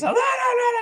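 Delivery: very high mock-frantic chatter; frantic protest. Other driver getting out of his car, frantic high protest. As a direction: imitate a panicked man in a high squeal.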